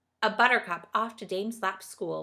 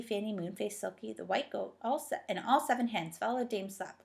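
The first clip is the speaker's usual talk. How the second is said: ordinary delivery, nothing marked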